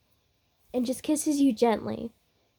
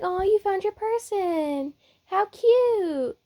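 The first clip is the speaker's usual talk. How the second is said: high, drawn-out adoring coo; cooing Zenitsu; 9 semitones higher, slower than usual. Acted. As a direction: coo over a cute couple. Zenitsu coos over the couple.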